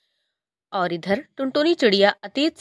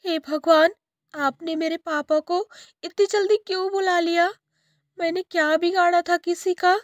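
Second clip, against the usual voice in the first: put-on high childlike voice, mildly tearful; a hint of weeping to God; tearful girl; 9 semitones higher than usual. Girl alone in her room, high and lightly tearful. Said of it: "वह रोने लगती है।".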